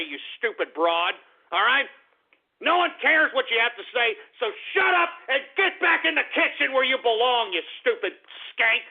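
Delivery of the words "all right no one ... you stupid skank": angry shout. Real, not acted. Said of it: "I'm a little angry".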